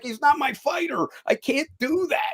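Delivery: high-pitched protest; retold pleading. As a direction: plead your way out of it. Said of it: "I'm pleading with the".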